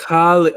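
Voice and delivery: "Chale" with a loud, throaty ch as in Bach; emphasized chaf. Shown as a demonstration. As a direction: punch the guttural first sound of "Chale". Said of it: "you were emphasizing the chaf".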